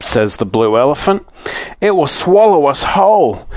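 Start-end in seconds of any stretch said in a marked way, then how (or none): 1.8-3.5 high scared gasping cry; frightened. A narrator's reading. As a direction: voice it like a terrified child. Everyone gulps and gasps.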